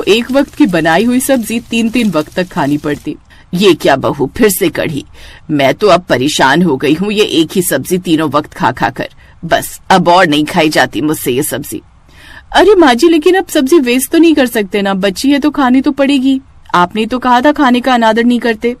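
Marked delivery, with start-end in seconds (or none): 3.5-11.8 put-on lower, weary old mother-in-law voice; tired elderly woman complaining at the table; grumbling mother-in-law. Mother-in-law fed up with curry. 12.6-18.8 sass her mother-in-law sweetly; bright mock-innocent retort; cheeky daughter-in-law. Daughter-in-law talks back.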